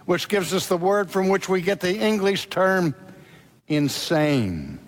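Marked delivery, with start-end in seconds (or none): none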